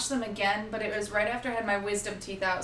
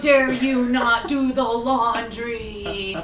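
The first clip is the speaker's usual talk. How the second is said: slow, loud nagging mother; scolding mom; 9 dB louder, much slower than usual. Acted. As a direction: drag it out like an angry mom.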